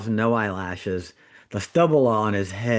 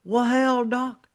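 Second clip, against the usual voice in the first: much higher hammed-up old Southern patient voice, drawn out; 13 semitones higher, 4 dB louder, slower than usual. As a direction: play the old-timer dead obvious, slow and laughing. Old burned patient answers the intern.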